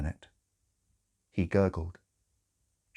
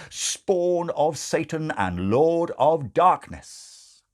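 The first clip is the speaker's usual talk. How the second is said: grand build; 8 semitones higher, 5 dB louder, much slower than usual. A narrator's reading. Grand litany of devil's titles.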